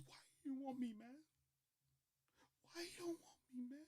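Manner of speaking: high, breathless whisper; mock crying, barely voiced; whispered sobbing plea. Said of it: "starts crying".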